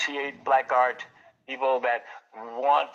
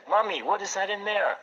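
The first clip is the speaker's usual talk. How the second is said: put-on higher-pitched, curious child's question; lightly voiced child; 7 semitones higher than usual. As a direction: imitate a child asking mommy, lightly. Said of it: "I see children pass by my store".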